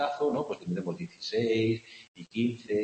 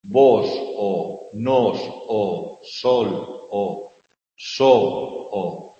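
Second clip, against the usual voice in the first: loud, slow stress on every "O" in the words, one by one; hitting each vowel; 10 dB louder, much slower than usual. Shown as a demonstration. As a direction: punch out each "O" for the class. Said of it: "seguimos teniendo la O".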